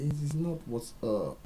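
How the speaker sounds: high, slow, stressed correction; slow and high, hammer each word; high slow insistent correction; emphatic correction. Real, not acted. Correcting comedian's phrase.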